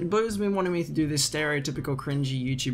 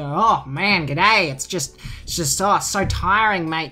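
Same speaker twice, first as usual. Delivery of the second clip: louder, higher, slow over-the-top Aussie; exaggerated Australian accent; 4 semitones higher, 6 dB louder than usual. Parodying what viewers think he sounds like, in a hammed-up Australian accent. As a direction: ham up a tired Aussie drawl. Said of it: "That's putting on an accent".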